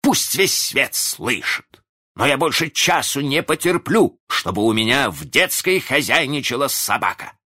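high-pitched, indignant declaration; resolute outburst. A narrator's reading. Father banishing the nursery dog, loudly resolute. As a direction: proclaim it for everyone to hear. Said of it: "отвечал он решительно".

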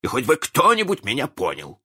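high-pitched, aggrieved self-pity; bitter complaint. A narrator's reading. Misunderstood father to his family, bitterly complaining. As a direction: lament that nobody appreciates you. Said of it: "с горечью".

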